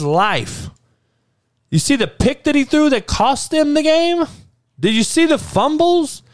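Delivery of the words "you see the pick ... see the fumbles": much higher, pressing each question; incredulous. Real, not acted. Listing the game's mistakes to Dan, much higher incredulous questions. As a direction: rub it in, disbelieving. Piling on evidence against Dan.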